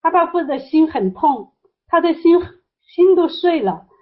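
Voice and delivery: pleading retelling, pitched high; retold heartache. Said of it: "阿巴父很伤心". (转述时高声痛心)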